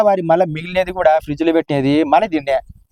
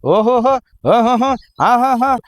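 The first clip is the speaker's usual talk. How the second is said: mocking the influencer; 9 semitones higher, much slower than usual. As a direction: ham up the YouTuber's show-off cry. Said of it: "శిప్ప తలకాయోడు".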